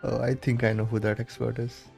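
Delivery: ordinary talk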